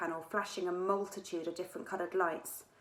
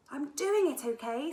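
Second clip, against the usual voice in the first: firm and louder, higher, a bit defensive; 7 semitones higher, 5 dB louder than usual. A narrator's reading. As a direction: stand your ground under pressure. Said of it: "said Zia firmly".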